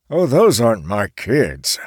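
casual grumpy grandpa; old man voice. Acted. Charles answers plainly.

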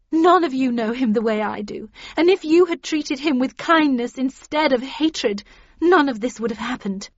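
loud, earnest protest; impassioned defence. A narrator's reading. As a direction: defend your lover hotly to a hostile group. Catherine defends Heathcliff to hostile group.

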